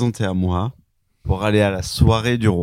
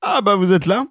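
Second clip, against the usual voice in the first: surprised; 11 semitones higher, 4 dB louder than usual. Acted. King greets, high.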